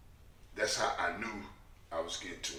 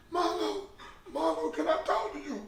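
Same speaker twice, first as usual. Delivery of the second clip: high, pleading, half-laughing lady voice; mock crying lady; 4 dB louder than usual. Weepy lady at the club door begging Marlo, mock high plea. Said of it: "it's this lady outside the door crying".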